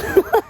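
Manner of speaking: high, loud delighted laugh; burst of laughter. Real, not acted. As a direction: laugh out in pure joy. Delighted laugh at good luck.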